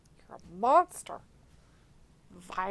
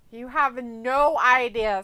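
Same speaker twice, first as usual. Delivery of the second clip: mock boast; 9 dB louder, slower than usual. Real, not acted. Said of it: "how evil of a witch laugh I can do".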